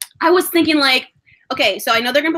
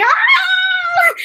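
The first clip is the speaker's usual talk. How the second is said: fangirl scream; 5 dB louder than usual. Retold or imitated. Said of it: "we're like".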